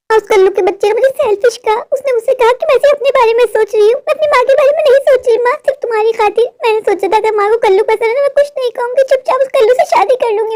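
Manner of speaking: put-on high girlish voice, hurt; a spoiled daughter let down by her mother; whiny daughter. Daughter Gudiya complains.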